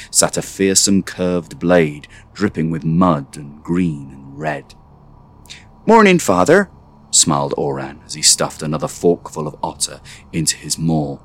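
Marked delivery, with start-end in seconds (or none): 5.9-6.7 put-on bright high boy voice; a young lad chirping good morning; Oran's cheerful greeting. A narrator's reading. Oran greets father cheerfully.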